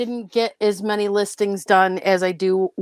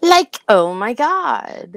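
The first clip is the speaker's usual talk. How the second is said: put-on Valley Girl accent; 3 semitones higher, slower than usual. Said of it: "Valley Girl".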